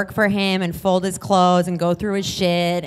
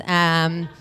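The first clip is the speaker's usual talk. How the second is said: normal talking voice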